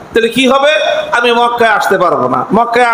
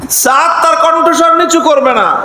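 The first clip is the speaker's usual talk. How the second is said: "নিচু" pushed high and firm on the repeat; raised repeat; 5 semitones higher than usual. Retold or imitated. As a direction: repeat it higher, refusing to back down. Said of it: "আরো চেচ্ছেন সাদ ইবনে মুআজ". (দ্বিতীয়বার উঁচু গলায় "নিচু" জোর দিয়ে)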